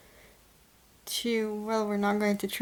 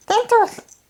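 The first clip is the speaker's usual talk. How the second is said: excited squeal; 13 semitones higher, 8 dB louder than usual. Real, not acted. Excited call to the cat.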